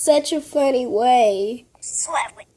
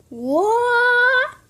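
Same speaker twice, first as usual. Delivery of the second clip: squeaky, much higher question of disbelief; baffled what; 12 semitones higher, 3 dB louder than usual. Real, not acted. Girl baffled by the voice app's answer, squeaky disbelief. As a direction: shoot it way up in total confusion.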